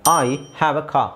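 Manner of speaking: slow word-by-word model, "car" as "kaa"; slow, silent R. Shown as a demonstration. Teacher repeating car slowly for students, silent R. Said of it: "ನಾನು ಆರ್ ಹೇಳಲಿಲ್ಲ ಇಲ್ಲಿ".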